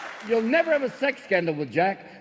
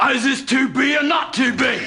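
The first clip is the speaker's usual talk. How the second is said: loud theatrical Shakespeare shout; 5 semitones higher, 4 dB louder than usual. Acted. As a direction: declaim it like a ham Shakespeare. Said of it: "I'm William Shakespeare".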